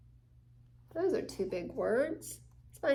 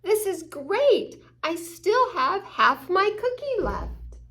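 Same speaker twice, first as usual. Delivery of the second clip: beam with joy at the half cookie; cheerful optimism; 8 semitones higher, 7 dB louder than usual. Acted. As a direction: optimist delighted with half a cookie, bright and excited. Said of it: "But optimistic means".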